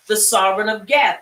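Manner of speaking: ordinary talk, nothing marked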